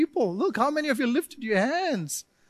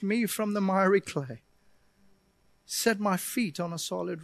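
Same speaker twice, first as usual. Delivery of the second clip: quiet earnest prayer; 3 semitones lower, 3 dB quieter than usual. Pretend. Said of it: "and say".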